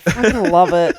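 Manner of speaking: ordinary talk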